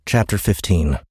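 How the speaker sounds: usual speaking voice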